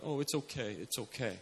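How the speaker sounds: very soft, low imitated soothing; coddling. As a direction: hush them with quiet, low reassurance. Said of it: "Instead, we'd just be like".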